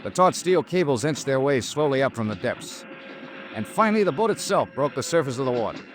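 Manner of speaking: even, measured detective narration; hard-boiled investigator narrating an expense report; story narration. Acted. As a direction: recount the case to the listener, matter-of-fact. Detective narrates salvage scene.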